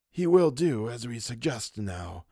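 a hint of the sly old man. Wilde, satisfied.

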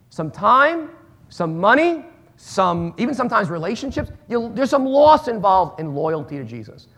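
ordinary talk

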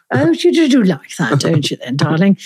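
hammed-up Queen's English, swooping pitch. Shown as a demonstration. Host says keep going, hammed-up Queen's English accent. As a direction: play it grandly in Queen's English. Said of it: "I had to speak the Queen's English only".

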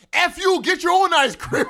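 loud, high hammed-up outburst; mad ice cream man. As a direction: yell at the customer in mock rage. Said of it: "they're like".

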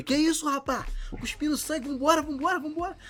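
high, hurried alarmed voice; imitating a frantic older brother; panicked brother. (irmão desesperado, voz aguda e apressada)

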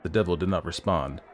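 ordinary delivery

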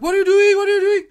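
high, loud panicked shout; startled driver shouting at a stranger in his car; panicked yell. Retold or imitated. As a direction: yell it twice in alarm. Scared driver yelling at stranger.

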